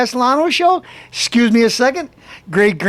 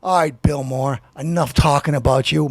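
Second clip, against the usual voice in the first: ordinary delivery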